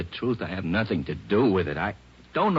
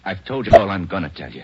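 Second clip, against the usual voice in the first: much louder defiant snap; defiant outburst; 2 semitones higher, 10 dB louder than usual. Acted. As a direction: slam the door on questions. Defiant suspect.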